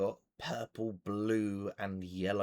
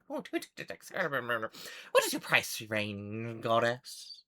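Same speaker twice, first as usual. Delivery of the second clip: gnome muttering, then drawn-out question; eccentric gnome; slower than usual. Acted. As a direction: mumble the order over, then ask grandly. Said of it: "They're like".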